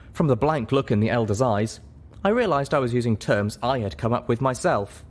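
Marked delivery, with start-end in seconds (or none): none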